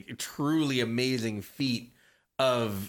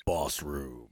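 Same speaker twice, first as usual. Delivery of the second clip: much lower, softer movie-trailer voice; deep announcer sting; 12 semitones lower, 5 dB quieter than usual. Acted. Cohost announcing the new segment in a deep trailer voice. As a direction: intone the segment name like a trailer.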